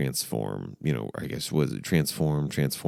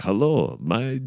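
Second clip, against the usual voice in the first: higher, botched German accent; mock German greeting, pitched up; 7 semitones higher, slower than usual. Shown as a demonstration. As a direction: attempt a German accent and give up. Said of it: "I can't do the German".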